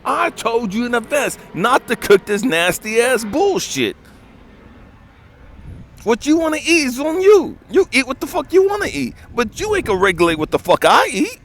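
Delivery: loud, high, fast angry rant; furious boyfriend yelling over thrown-out beans; furious retold rant. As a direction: tear into her, no holding back. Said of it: "And it's like".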